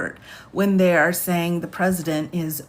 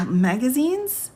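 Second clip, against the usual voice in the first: a rising, laughing question at the word; laughing disbelief; 7 semitones higher, slower than usual. Real, not acted. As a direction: break into a laugh reading magazines?. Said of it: "Hold on, everybody".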